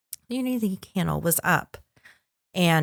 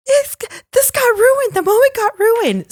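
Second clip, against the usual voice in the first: high-pitched, louder mock wail; exaggerated upset squeal; 6 dB louder than usual. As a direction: whine it like a distraught bride. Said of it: "I got upset".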